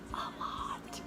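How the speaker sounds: unvoiced confiding whisper; whispered aside. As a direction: breathe it out as a secret. Confessing in a whisper.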